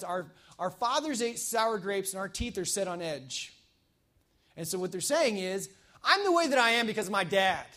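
rally the congregation to go and act; fervent preaching. Acted. Pastor urging the congregation to reach out, fervent. Preaches the keys at full force.

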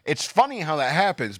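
usual speaking voice

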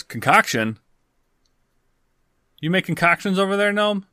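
high, quick intrigued question; keen interest. Acted. Farmer asking the gnome about concoctions, eagerly high. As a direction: call across with voice jumping up.

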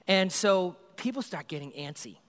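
normal talking voice

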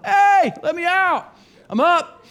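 high, slow, hammed-up call from inside; mock call from tomb. Pastor imitating Jesus inside the tomb, hammed-up high call. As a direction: play it as someone stuck calling to be let out, high and slow.

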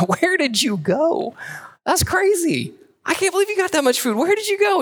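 high, amazed squeal of disbelief; high-pitched, astonished mother-in-law; excited Naomi. Retold or imitated. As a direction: play Naomi stunned by the huge haul. Naomi voiced in excited retelling.

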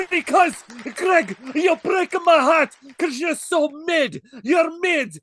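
loud trash-talking wrestler; wrestling promo shout. Acted. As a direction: yell the insults like a heel. Mock wrestling promo insults.

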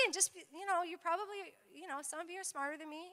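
ordinary talk, nothing marked

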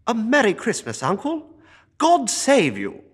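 high, loud, cheerful greeting; cheerful cry. A narrator's reading. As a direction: call out the greeting with joy. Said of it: "cried a cheerful voice".

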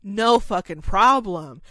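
loud surprised whoa, then slow eager yes; startled then happy to help; surprised eager caregiver. Retold or imitated. Caregiver stunned the resident speaks, loud surprised then slow. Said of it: "And it's like".

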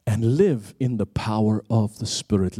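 ordinary delivery

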